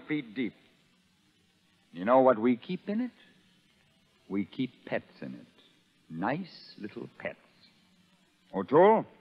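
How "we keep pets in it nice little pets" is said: soft, slow sinister purr; menacing hush. Acted. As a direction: savour the threat quietly. Sergeant menaces about sharks.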